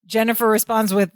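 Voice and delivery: usual speaking voice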